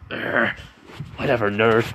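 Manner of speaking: growled groan into a mock-annoyed retort; acted irritated brush-off; grumpy dismissal. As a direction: grumble it like a sore rival. Acted rival brushes off the boast.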